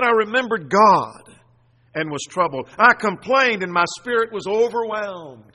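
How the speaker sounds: loud, fervent declamation in bursts; crying out. Acted. Preacher voicing the psalmist's cry unto his God, crying out. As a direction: proclaim the troubled psalmist's lament forcefully. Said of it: "Here's his cry unto his God".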